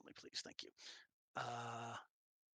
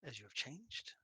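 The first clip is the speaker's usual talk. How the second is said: ordinary talk, nothing marked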